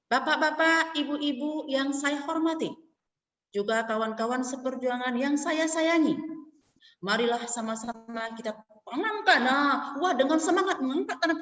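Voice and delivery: mock fiery formal speech; speech demo. Shown as a demonstration. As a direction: play an overeager speaker at the podium. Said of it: "Ibu kasih contoh".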